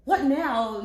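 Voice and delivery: loud exasperated demand; irritated. Retold or imitated. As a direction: snap at the phone. Irritated at phone.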